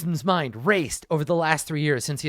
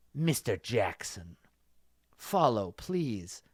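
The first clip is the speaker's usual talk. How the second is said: put-on low, slow old master voice; lightly voiced old kung fu master; Chinese master voice; 6 semitones lower, 8 dB quieter, slower than usual. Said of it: "this was written in the, like, really over-the-top, like, Chinese master voice".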